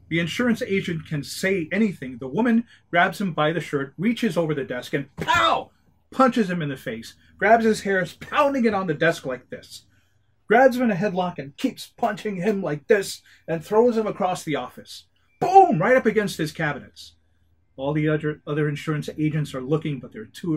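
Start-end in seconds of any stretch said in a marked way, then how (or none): 4.9-5.7 shouted, higher "pow!" sound effect; punch sound effect. Acted. Narrator acts out the punch, a loud high pow. 15.4-15.8 loud, high "Boom!" impact shout; crash sound effect. Acted. Narrator acts out the crash, a loud high boom. Agent thrown into cabinets.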